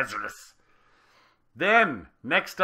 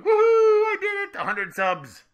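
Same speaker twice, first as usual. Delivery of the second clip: much higher, louder laughing whoop of joy; inner cheer; 11 dB louder than usual. Retold or imitated. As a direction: cheer it out like you just won. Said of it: "I was going".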